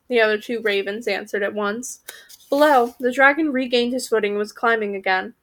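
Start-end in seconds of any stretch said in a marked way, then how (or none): none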